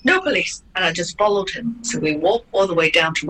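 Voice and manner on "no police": P=A; firm falling echo. Retold or imitated. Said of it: "I said".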